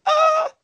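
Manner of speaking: shrill squeaky yelp; high squeak. Real, not acted. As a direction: squeak like a toy. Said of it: "That's your nickname".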